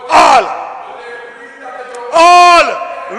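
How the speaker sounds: a real shout of triumph; shouted promise. Real, not acted. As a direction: bellow the pledge like a rally. Shouting election promise in parliament.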